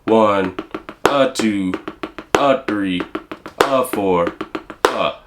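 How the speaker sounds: slow, spaced count with a pause before each "a two", "a three". Shown as a demonstration. Teacher counts slowly, beat by beat, for students. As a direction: count it out with gaps between beats. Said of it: "It would be like this".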